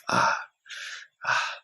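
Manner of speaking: short panting gasps; showing how he gasped for breath; gasping breaths. Shown as a demonstration. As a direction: gasp for air, out of breath. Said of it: "Je respire comme ça".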